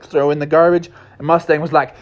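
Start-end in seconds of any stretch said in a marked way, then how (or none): none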